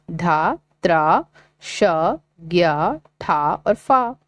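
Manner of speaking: put-on each syllable said slowly and apart for children to repeat; slow syllable reading. Shown as a demonstration. Teacher reading syllables slowly for pupils to copy. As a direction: read the letters slowly, one by one. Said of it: "पढ़ें और लिखें".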